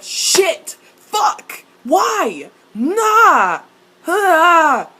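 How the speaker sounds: loud, high cries; exasperated outburst. Real, not acted. Viewer at a loss over the plot, exasperated shouting. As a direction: blurt each word as a loud cry.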